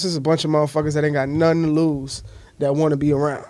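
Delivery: ordinary talk, nothing marked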